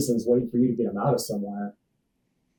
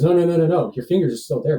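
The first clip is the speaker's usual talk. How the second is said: higher, louder rush of no's; imitating urgent reassurance; 6 semitones higher, 5 dB louder, faster than usual. Friend answers his fear of lost fingers, imitated urgent reassurance. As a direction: play a friend hastily correcting him.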